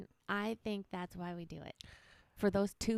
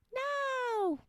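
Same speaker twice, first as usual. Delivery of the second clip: very high mock Aussie no; Australian no. Wife voices the victim in her joke, very high Aussie no. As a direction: squeak no like now. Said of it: "it sounds like now".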